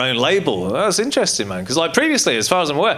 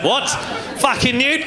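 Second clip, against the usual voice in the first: high-pitched mock Australian jeer; put-on Australian disbelief; Australian accent; 11 semitones higher than usual. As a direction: throw it out as an Australian heckler. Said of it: "Fucking got the Australians in".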